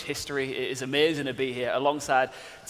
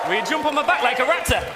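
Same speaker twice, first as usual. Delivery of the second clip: excited shout; 8 semitones higher, 4 dB louder than usual. Real, not acted. Co-host jokes, shouting.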